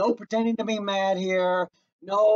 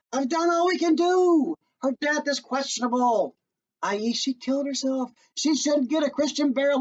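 priest; 6 semitones higher than usual. Acted. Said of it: "the priest says".

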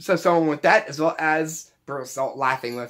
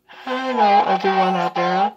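high, louder cheery elf through a voice changer; 4 semitones higher, 4 dB louder than usual. Shown as a demonstration. Elf wishing everyone Merry Christmas, high and cheery. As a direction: greet everyone like a chirpy elf. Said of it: "you sound like the elf talking".

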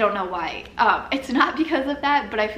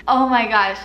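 louder, grossed-out exclamation; disgusted outburst; 8 dB louder than usual. Real, not acted. Recalling the broccoli crunch, louder in disgust. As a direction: blurt it like the taste is back.

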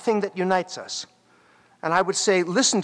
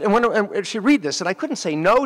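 ordinary delivery

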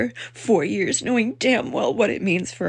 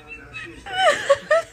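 laughing crying. Real, not acted. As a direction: laugh and cry at once, out of control. Reacting to the election result.

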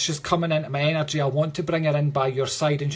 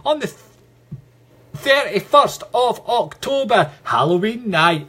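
excited announcement; 6 semitones higher, 5 dB louder than usual. Real, not acted. Medium announcing the Halloween ghost hunt, excited and loud. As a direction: ring out the date like a showman.

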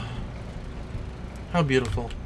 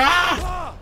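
sudden high shriek; startled scream. Real, not acted. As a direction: jolt into a scream. Startled mid-sentence.

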